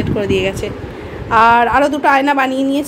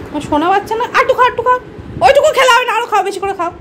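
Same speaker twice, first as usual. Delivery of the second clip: high, doting urging; 9 semitones higher, faster than usual. Real, not acted. Urging the pet to drink more, coaxing baby talk. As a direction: coax a little one in a pitched-up voice.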